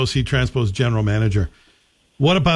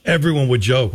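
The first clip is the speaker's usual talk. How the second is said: usual speaking voice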